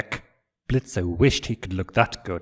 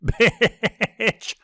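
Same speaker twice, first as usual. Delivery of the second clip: much higher, laughing outburst; laughing insult; 13 semitones higher, 4 dB louder than usual. Acted. Blitz laughing at Stolas, shouting an insult. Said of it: "Blitz laughed out".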